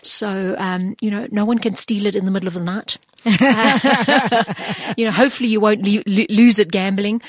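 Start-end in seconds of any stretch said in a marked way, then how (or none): 3.2-7.2 laughing. Real, not acted. Laughs at her own joke.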